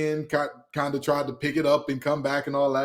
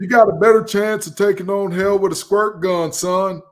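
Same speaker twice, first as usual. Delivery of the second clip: loud, high barking coach; coach's voice; 7 semitones higher, 7 dB louder than usual. Acted. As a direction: bark it like a gym coach. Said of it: "my coach's voice for this one".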